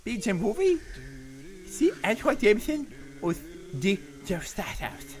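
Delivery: put-on stammering babyish read, squeaky breaks; baby talk. Asked to talk like a baby, host stammers the question babyishly. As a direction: stumble through it like a toddler. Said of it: "Talk like a baby".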